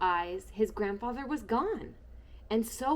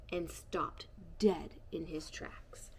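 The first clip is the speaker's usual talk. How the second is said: slow, hushed suspense whisper; 4 semitones lower, 6 dB quieter, slower than usual. A narrator's reading. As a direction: drop to a whisper for the surprise. Suspense in the narration.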